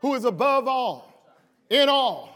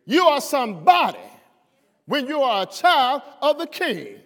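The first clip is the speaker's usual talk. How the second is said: preaching; 3 dB louder than usual. Acted.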